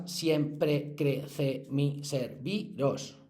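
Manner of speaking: very slow, syllable by syllable; counting syllables. Shown as a demonstration. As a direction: tap out each syllable of "siempre crece mi serviros". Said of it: "Si contáis las sílabas". (silabeando muy despacio "siempre crece mi serviros")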